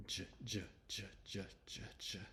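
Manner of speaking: "J" said as its sound, like a soft G, not the letter name; short repeated "J" sounds in pairs; isolated J sound. Shown as a demonstration. Teacher introducing the next letter to students, isolated J sound. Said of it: "The next letter is J".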